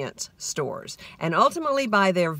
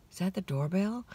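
dreamy half-asleep; 7 dB quieter than usual. Retold or imitated. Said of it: "I say that disclaimer here".

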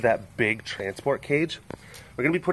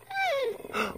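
high gasp; 8 dB quieter than usual. Real, not acted. Sees a cat arrive.

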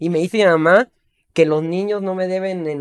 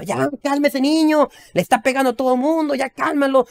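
higher mock scolding uncles; imitating uncles; 8 semitones higher than usual. Uncles scolding the mother, higher mock voice. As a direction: play the annoyed uncles telling mom to calm the kid. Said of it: "y mis tíos decían".

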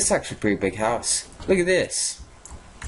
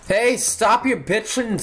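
yelled at neighbour; 9 semitones higher than usual. Acted. Shouting at crying neighbour.

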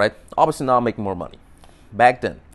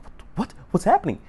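higher, alarmed questions; imitating the girlfriend's alarm; alarmed girl; 10 semitones higher than usual. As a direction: play the confused, startled girl. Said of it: "Girl's like".

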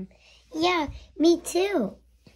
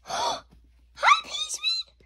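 gasping squeak; 3 dB quieter, slower than usual. Acted. Toy gasps at friend arriving.

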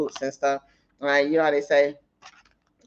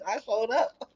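laughing; 11 semitones higher, slower than usual. Real, not acted. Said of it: "repeats with laugh".

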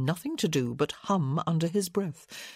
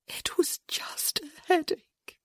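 slow, high, whispery whimper; broken; 12 semitones higher, slower than usual. A narrator's reading. Broken wife repeating herself, in a tearful whisper. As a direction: say it weakly, breath giving out. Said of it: "she looked broken".

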